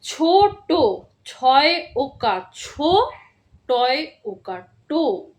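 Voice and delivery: slow spelling out. Shown as a demonstration. Teacher spelling out a word slowly for first graders. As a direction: spell each letter slowly and clearly. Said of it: "আরেকবার বলে দিচ্ছি কিভাবে পড়তে হবে".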